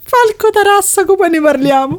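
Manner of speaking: laughter breaking through the words; suppressing giggles while repeating the name; trying not to laugh. Real, not acted. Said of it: "non ce la faccio". (ridendo sotto i baffi)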